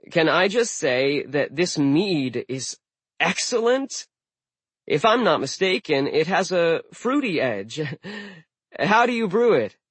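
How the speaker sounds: butter up the host; flattering Alex. Acted.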